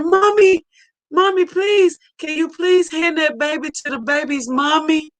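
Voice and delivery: put-on high, pleading little girl voice; lightly voiced little girl pleading; a child pleading. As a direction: beg mommy like a small child. Child self voiced pleading mommy.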